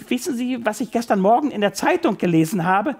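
bubbling over with news; excited. Acted. Excited assistant bursts out news. (ganz aufgeregt fragend)

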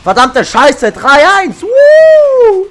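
loud, high triumphant screaming; excited yelling. Real, not acted. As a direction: scream with joy at the score. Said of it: "werde ich noch ein bisschen lauter".

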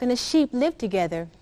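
plain reading voice

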